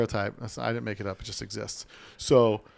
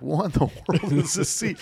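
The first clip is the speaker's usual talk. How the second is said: laughing disbelief; 7 semitones higher, 3 dB louder than usual. Retold or imitated. Sitting on overstuffed seat.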